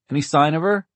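high, loud question with rising end; calling out. A narrator's reading. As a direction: call it out from the car window.

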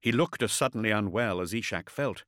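usual speaking voice

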